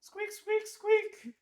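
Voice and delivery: very high squeaky toy squeaks; rubbery high squeaky toy. Acted. Squirrel king squeaking.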